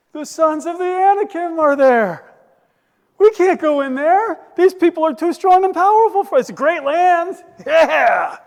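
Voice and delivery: high, loud hammed-up outcry; alarmed shouted report. Spies reporting back to the people, acted high shouting. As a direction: shout it like a panicked scout's report.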